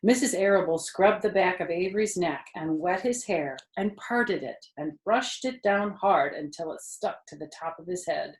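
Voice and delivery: ordinary delivery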